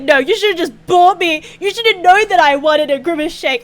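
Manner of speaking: mock indignant outburst, high and loud; retold tantrum. Dreamer running from partner over a shake, mock tantrum. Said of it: "I throw tantrums in my dreams".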